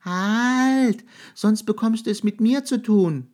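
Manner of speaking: high, loud little mouse voice; mouse warning. A narrator's reading. Brave little mouse threatens the huge monster, high and loud.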